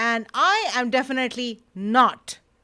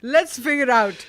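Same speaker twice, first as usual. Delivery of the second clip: dramatic announcer; 4 semitones higher, 8 dB louder than usual. Acted. Dramatic show teaser.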